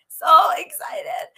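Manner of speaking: high, loud, drawn-out squeal of excitement; giddy excitement. Real, not acted. Host bursting with excitement about her guest, giddy. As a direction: let the giddiness burst out, stretching the words high. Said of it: "go back to my giddiness".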